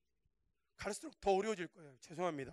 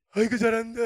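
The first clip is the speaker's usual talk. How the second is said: louder mock cheer from a grandfather; doting grandfather; 2 semitones higher, 9 dB louder than usual. Grandfather applauding his granddaughter's dance, mock approval. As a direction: cheer them on like an approving old man. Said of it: "요즘 할아버지들은 뭐라 하는지 아세요?".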